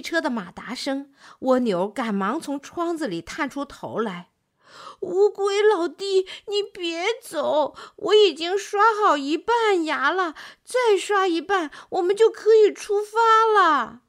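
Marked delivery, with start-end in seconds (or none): 5.0-14.0 高声急忙叫住对方; 别走, 急着留人; 蜗牛急着喊. A narrator's reading. 蜗牛急忙挽留乌龟. (蜗牛急急忙忙叫住要走的乌龟)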